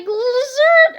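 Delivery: high, loud zap noise; zap sound effect. Acted. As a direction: blurt the ray gun's zap.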